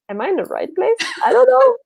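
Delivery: high-pitched mock confusion, rising to a squeal; bewildered tourist. Tourist unsure it is the right place, acted bewilderment. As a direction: squeak it like a lost newcomer. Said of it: "and you're just like".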